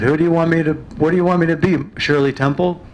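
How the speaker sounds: indignant retort. Retold or imitated. Daughter argues back at mother.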